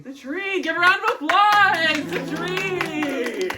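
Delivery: hammed-up cheer, loud; mock celebration. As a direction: cheer it like a game show host. Mock celebrating wrong answer.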